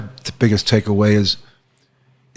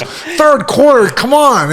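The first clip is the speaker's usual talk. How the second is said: mocking protest; 12 semitones higher, much slower than usual. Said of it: "I goes".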